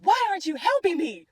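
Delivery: high, mild mock scream; retold scream. Driver retelling her plea to the heavens, mock screaming. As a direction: pitch it up in an imitated desperate cry. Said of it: "I scream".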